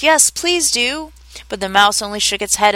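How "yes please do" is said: high, slow, drawn-out entreaty; chorused plea. A narrator's reading. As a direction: plead like a chorus, slowly. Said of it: "the others all joined in chorus".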